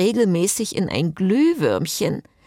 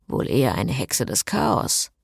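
grumbling; 6 semitones lower than usual. A narrator's reading. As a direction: mutter it under the breath, lower. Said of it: "murrte Frederic". (leise gemurrt)

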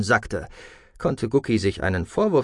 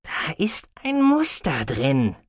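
slow, higher character voice, musing; murmuring creature; 4 semitones higher, slower than usual. A narrator's reading. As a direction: murmur it slowly, drawn out, as the little Ilt. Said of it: "murmelte er". (langsam grübelnd gemurmelt)

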